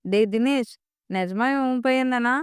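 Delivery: plain reading voice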